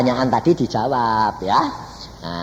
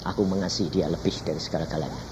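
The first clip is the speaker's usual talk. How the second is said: soft, lower, quick confession; tender confession; 7 semitones lower, 10 dB quieter, faster than usual. Retold or imitated. Preacher retelling his devotion to Jesus, softly and quickly. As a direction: murmur it with devotion. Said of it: "Saya berkata".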